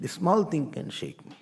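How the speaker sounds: usual speaking voice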